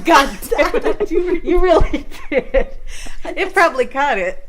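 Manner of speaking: laugh-shout in disbelief; laughing outburst. Real, not acted. Reacting to a fart.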